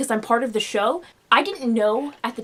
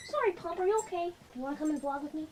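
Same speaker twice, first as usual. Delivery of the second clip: soft, high pet-talk voice; coaxing a dog; 5 semitones higher, 10 dB quieter, much faster than usual. Real, not acted. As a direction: apologize gently to a startled pup. Soothing the scared dog.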